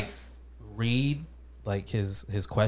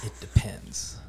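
whisper; 2 semitones lower, 5 dB quieter, slower than usual. Real, not acted. Dry joking answer.

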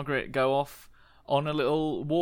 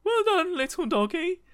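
praising the dog; 5 dB louder than usual. Acted. John praises the dog after its backflip, high cooing voice. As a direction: coo it up high like talking to a puppy. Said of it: "so he goes".

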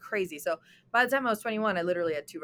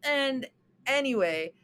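normal talking voice

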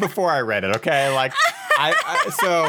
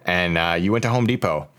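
slow, low post-reading voice; missed-connection read; 5 semitones lower than usual. Acted. Podcaster reads a Craigslist love note to Morgan, slow earnest read.

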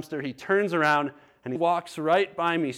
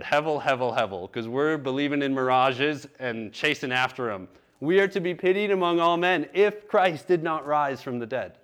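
normal talking voice

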